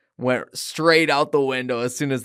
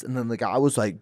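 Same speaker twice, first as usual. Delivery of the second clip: usual speaking voice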